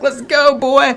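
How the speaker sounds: high, loud mock hype; hyped-up battle cry. Streamer voicing his general dropping the elbow, high hyped-up holler. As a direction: holler it as the pumped-up fighter. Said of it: "he just, like".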